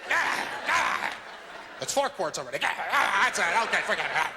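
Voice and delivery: high, mocking yeah yeah; sarcastic impatience. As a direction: sneer at the delay. Mock urging, sarcastic bladder talk.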